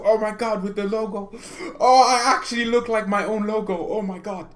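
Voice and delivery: high, loud, squealing mock horror; overwhelmed by all the red, half laughing; excited disbelief. Real, not acted. Reacting to wearing red shirt.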